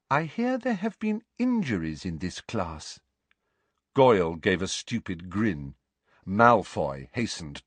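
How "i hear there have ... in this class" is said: Umbridge voice. Acted. Umbridge voiced, sugary high.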